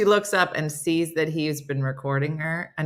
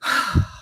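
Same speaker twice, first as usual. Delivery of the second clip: big demonstrative sigh; exaggerated heavy breath out; biggest exhale. Shown as a demonstration. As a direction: let out a huge exhale instead of answering. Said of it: "the biggest exhale".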